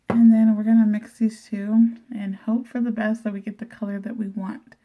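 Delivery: ordinary talk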